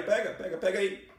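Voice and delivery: careless offhand. Retold or imitated. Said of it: "Como se fosse uma bijuteria".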